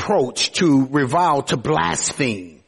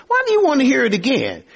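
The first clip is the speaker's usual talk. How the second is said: exasperated; 9 semitones higher, much faster than usual. Acted. Blind man voiced, exasperated.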